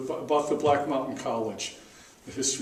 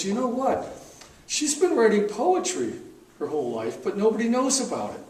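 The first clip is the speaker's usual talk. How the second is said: higher-pitched retelling of an excited tip; excited friend; 8 semitones higher, 4 dB louder than usual. Retold or imitated. Poet friend tells publisher about her secret poetry, excited. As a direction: imitate a friend sharing a discovery. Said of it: "John was so excited about all that".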